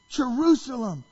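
high, loud cry of grief; weeping lament. Retold or imitated. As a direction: call out in acted anguish. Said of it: "falls to his knees and he weeps".

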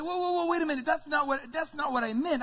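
high-pitched, hurried protest; alarmed objection. Acted. Preacher voices believers balking at answered prayer, alarmed objection. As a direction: backpedal in alarm, pitch jumping up. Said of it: "we would be like".

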